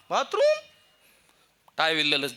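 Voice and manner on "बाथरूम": very high surprised rise; surprised question. Retold or imitated. Officer asks in surprise about the bathroom, very high. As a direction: ask it in disbelief.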